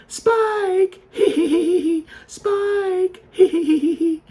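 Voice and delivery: high, squeaky mocking kid voice with giggles. Kids at the lake mocking the yelling owner, high giggly taunt. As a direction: mimic teasing kids laughing at him. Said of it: "they start mocking me".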